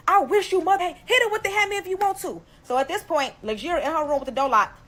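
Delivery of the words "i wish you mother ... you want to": much higher, louder mom voice egging her on; mom on speaker. Retold or imitated. Mom on speakerphone urging her daughter, higher and louder. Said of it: "My mom in the background like".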